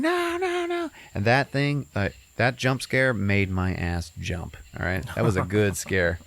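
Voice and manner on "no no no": put-on high, panicked child's cry; the kid yelping as he's dragged away; high scared no no no; terrified kid. Kid dragged under bed screaming.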